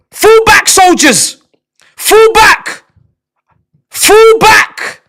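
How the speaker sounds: lightly voiced army officer barking orders; a hint of a battlefield general; mock commander. Plays commander ordering troops.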